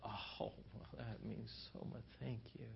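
very soft, breath-light gratitude; acted tender, hushed thanks; deeply touched. Touched person receiving loving words.

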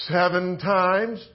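slow, self-satisfied reply; slow proud answer. Acted. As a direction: draw out seven times, pleased. Peter's answer, drawn out.

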